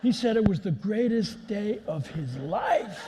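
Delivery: loud, high, drawn-out glee; retold triumph. Storyteller crowning the boy's win over Grandma, triumphant. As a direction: stretch it out loudly in mock triumph.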